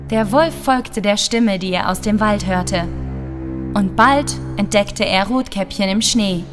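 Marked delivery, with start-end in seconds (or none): none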